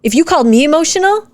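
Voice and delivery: ordinary delivery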